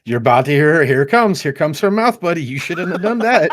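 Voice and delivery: high, excited mock play-by-play; gleeful heckling. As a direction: hype up the coming blowup, near laughing. Imagined gleeful commentary on friend's outburst.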